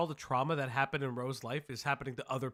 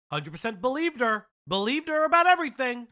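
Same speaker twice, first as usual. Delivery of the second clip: building to a high, loud exclamation; rising louder and higher, sarcastically emphatic; incredulous sarcasm; 8 semitones higher, 7 dB louder, faster than usual. Real, not acted. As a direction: hammer the claim in mocking disbelief. Sarcastic reaction to the clip's claim.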